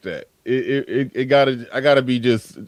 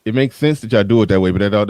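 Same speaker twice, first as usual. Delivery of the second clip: usual speaking voice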